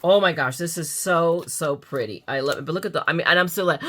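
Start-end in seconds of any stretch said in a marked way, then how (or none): none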